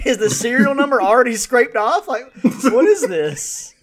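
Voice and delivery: loud, high, laughing incredulity; laughing disbelief. Real, not acted. Mocking the movie to his cohost, laughing loudly.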